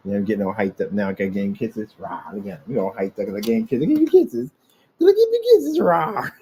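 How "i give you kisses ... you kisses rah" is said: squealy pet talk. Real, not acted. Owner squealing kisses at his cat, high and loud.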